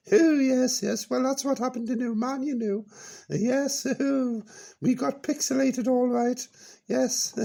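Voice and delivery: put-on high, bright-eyed old dear reminiscing; high character voice Peachy. Old character reminiscing to the radio host, high voice.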